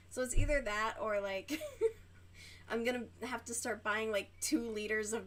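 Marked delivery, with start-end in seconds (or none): 1.6-2.6 breathy laugh. Real, not acted. Laughing at soda prices.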